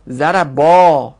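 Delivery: "ضربا" said zarabaa, ending in one long open a; fatha before alef. Shown as a demonstration. Teacher models the rule for students, "ضربا" as zarabaa with fatha. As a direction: draw out the last a of "ضربا", zarabaa. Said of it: "فتحه میاد".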